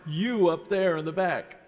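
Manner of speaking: loud call to the back of the hall. Real, not acted. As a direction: raise the voice to reach the back rows. Calling on a child at back.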